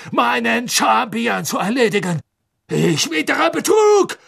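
indignant tirade climbing high; furious, high-pitched harangue; raging. A narrator's reading. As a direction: rant accusingly, building in outrage. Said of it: "offenbar rasend vor Zorn".

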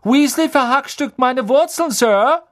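very high, loud complaint; rasping tattletale Malfoy. A narrator's reading. Malfoy tells on Ron to Snape, high rasping whine. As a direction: tell tales loudly, pitched right up. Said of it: "schnarrte Malfoy".

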